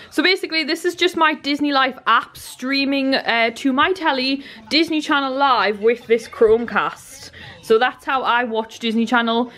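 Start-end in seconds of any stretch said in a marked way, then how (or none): none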